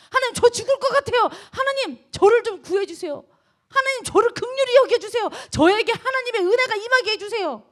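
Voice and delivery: loud, high-pitched begging, acted out; imitating a desperate shouted prayer; earnest crying out. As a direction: plead with God as if about to die, high and loud. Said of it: "이렇게 간절하게 소리를 질렀다는".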